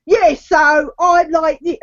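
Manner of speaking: hammed-up Cockney, stretched out; overcorrected fake Cockney. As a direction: imitate someone hastily forcing the Cockney back. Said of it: "she'd realise that she was slipping".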